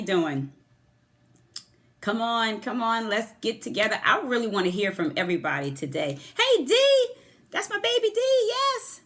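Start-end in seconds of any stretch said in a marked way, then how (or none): 6.4-9.0 much higher, louder gushing greeting; light up and gush over a dear friend; delighted squeal. Real, not acted. Host greeting her baby Dee on Periscope, squealing with delight. Greeting a beloved viewer live.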